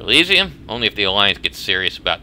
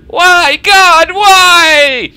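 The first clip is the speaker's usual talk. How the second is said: high, loud anguished wail; wailing grief; 13 dB louder, much slower than usual. Acted. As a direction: cry out to God, much higher and louder.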